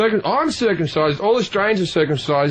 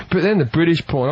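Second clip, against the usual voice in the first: usual speaking voice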